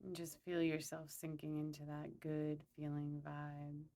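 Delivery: calm, unhurried meditation guide; guided meditation. Acted. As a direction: lead them gently through a visualization, pausing between phrases.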